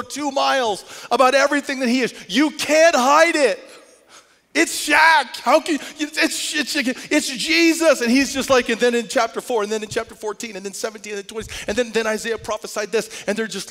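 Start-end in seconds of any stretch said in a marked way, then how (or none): 2.3-8.0 loud, high, slow preacher's exclamation; excited preaching. Real, not acted. Preacher to congregation, a loud, high exclamation. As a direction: burst out with the reveal.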